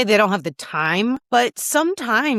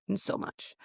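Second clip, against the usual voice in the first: tearful whisper; 13 dB quieter than usual. Real, not acted. Tearing up while talking.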